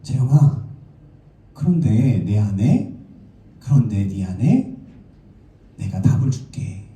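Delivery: slow, low voice gently calling; lightly voiced divine promise, unhurried and low; God. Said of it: "하나님께서 우리에게 다가오셔서 그런데 이렇게 한마디 하시는 거예요".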